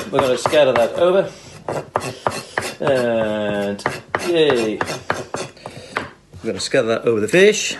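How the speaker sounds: ordinary talk, nothing marked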